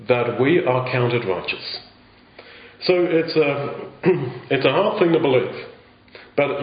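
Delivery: usual speaking voice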